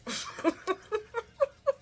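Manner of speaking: high-pitched giggle; short run of high laughter. Real, not acted. Laughing at Drew not knowing him, high giggle.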